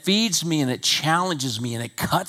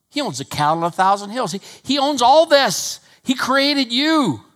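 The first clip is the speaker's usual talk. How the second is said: much higher, much louder preaching, last line slowed; full-force preaching; 12 semitones higher, 9 dB louder than usual. Acted. Pastor to church about God owning everything, thundering. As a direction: thunder it out to the congregation.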